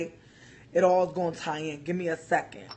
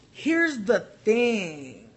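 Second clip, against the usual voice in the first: drawn out; much slower than usual. Real, not acted. Emphatic slow setup.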